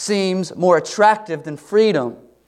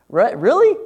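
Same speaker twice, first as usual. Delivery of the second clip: a higher, louder disbelieving "Really?"; incredulous; 8 semitones higher, 5 dB louder than usual. Real, not acted. Preacher reacting to the Israelites' memory, incredulous. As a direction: sound like you can't believe they'd say that.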